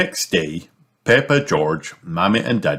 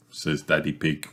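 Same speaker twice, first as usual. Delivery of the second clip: plain reading voice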